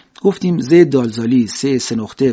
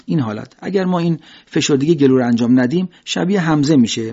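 normal talking voice

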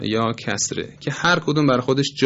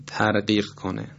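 ordinary talk

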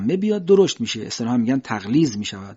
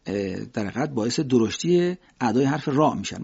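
normal talking voice

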